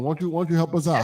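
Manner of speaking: ordinary delivery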